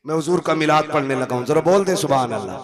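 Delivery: loud preacher rousing the crowd; preaching at full force. Acted. Preacher at a milad urging the crowd, loud fervent call. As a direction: rouse the gathering to respond.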